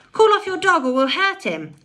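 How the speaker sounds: second man crying out. A narrator's reading. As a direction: shout the threat as a rough man. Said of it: "cried the second man".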